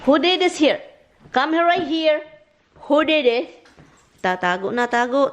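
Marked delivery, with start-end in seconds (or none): 0.1-3.7 high, insistent summons; accusing questions and commands, pitched up; scolding pets. Real, not acted. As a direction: call the culprits over in a high scolding voice.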